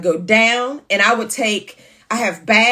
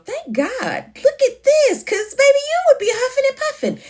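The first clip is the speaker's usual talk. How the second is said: thrilled exclamation. Retold or imitated. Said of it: "all I could do was go".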